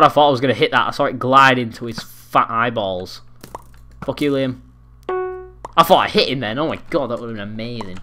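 ordinary talk, nothing marked

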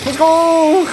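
high, loud drawn-out "let's go"; excited cheer. Real, not acted. Streamer cheering on the trailer action, excited cheer. Calling out excitedly during gameplay.

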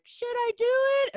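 high-pitched mock movie hero; squeaky, pleading imitation; Anakin impression. Quoting Anakin's question from the Star Wars scene, high mock impression.

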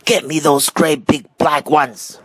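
high, strained mock shout; bossy old man calling out. A narrator's reading. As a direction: order it as if calling up a tree. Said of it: "the man was shouting".

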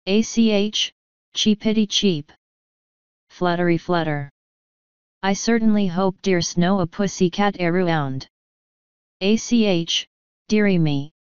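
lightly voiced little man playing a baby bird; chirpy mock cheeping in a Scots lilt; Feegle bird imitation. Little man fakes a baby bird to lure the cat, mock cheeping. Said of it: "it yelled".

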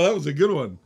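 ordinary talk, nothing marked

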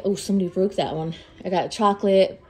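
ordinary talk

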